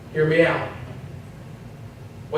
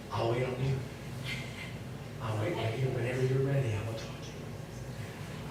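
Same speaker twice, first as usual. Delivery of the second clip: much softer, lower, patient teacher waiting; quiet teacher talk; 5 semitones lower, 15 dB quieter than usual. Retold or imitated. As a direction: sink to a near whisper, fading to breath. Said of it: "teacher who does the quiet talking".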